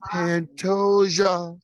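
very slow, drawn-out champion announcement; announcer call. Shown as a demonstration. Host showing his co-host the proper call, very slow and drawn out. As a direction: stretch it like a ring announcer. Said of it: "I'll say it twice".

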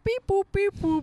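high, loud beeping alien; robot alien beeps. Pretend. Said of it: "You'd be like".

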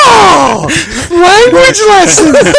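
high, loud yell through laughter; laughing shout. Real, not acted. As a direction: shout it out while cracking up. Mocking shout after "disgrace.

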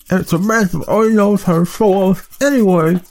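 high, mumbling through toothpaste; mouth full of toothpaste. Acted. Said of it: "mumbled through a mouthful of toothpaste".

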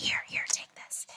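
whispered, furtive and kind; secret whisper. Retold or imitated. Lady hands over a note in a whisper. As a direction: slip it to her under your breath.

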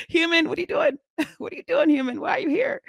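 very high, puzzled voice for the fish; squeaky fish voice. Retold or imitated. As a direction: play the fish, baffled by the human. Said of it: "looking at me like".

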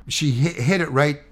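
normal talking voice

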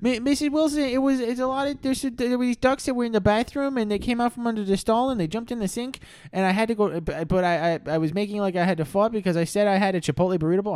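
put-on very high rambling kid; breathless little boy explaining. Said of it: "Jimmy was like".

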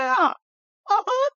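very high, cartoonish agreement; squeaky dog voice.

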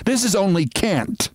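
high-pitched protest; indignant demand. A narrator's reading. Sylvia objecting to getting off the train, indignant. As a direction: protest it with pitch lifted well up. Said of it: "she demanded".

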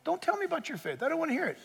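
high, soft, mock-impatient brush-off; acted refusal to listen; dismissive apostle. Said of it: "the book of James would say".

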